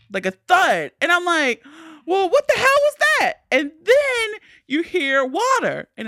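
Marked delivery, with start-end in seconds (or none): 2.0-3.3 high, loud, fast startled question; hammed-up high-pitched fright; acted alarm. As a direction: blurt it out in mock panic. Re-enacts alarm at thud.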